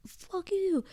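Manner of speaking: high, sharp mock retort; mock fuck-off. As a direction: spit it out high as a comic insult. Acted quote of angry retort.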